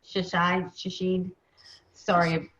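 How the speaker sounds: ordinary delivery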